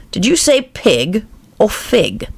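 slow, high, sly cat voice; drawn-out purring question from a grinning cat; Cheshire Cat. Acted. The cat on the branch asking Alice, slow and sly.

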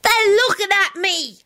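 put-on high, drawn-out child's wail. A narrator's reading. Boy dreading his turnips, wailing to his father. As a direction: whine it long and high. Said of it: "he wailed".

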